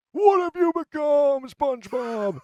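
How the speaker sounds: very high, loud, drawn-out wail; hammed-up anguished scream; tearful shout. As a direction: bellow it like a heartbroken cartoon character. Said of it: "tearing up before shouting".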